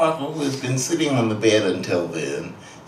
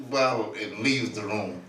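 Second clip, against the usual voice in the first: normal talking voice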